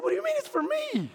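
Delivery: high, quick, baffled comeback; incredulous. Retold or imitated. Husband questioning wife in retold argument, high-pitched incredulity. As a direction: squeak out the retold disbelief, pitch leaping up.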